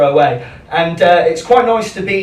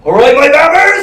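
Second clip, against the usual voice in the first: loud, high Bristolian station announcer; Bristol announcer accent; 9 semitones higher, 7 dB louder than usual. Retold or imitated. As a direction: belt out a cheery Bristol greeting over the tannoy. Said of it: "You can only hear this in Bristol".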